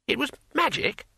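high astonished question; amazed. Acted. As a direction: ask it in wide-eyed wonder. Two Flower amazed.

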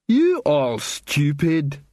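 very slow, blunt troll line. A narrator's reading. Troll insults the singer.

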